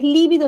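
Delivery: lívido stressed on the first syllable; esdrújula stress. Shown as a demonstration. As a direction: punch the LÍ in lívido. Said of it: "es esdrújula".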